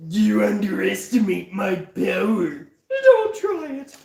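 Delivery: a hint of a menacing movie villain, with a high yelp on "Don't try it"; mock-menacing Sith line in a cartoon voice; Muscle Man as Star Wars villain. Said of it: "if Muscle Man was in Star Wars".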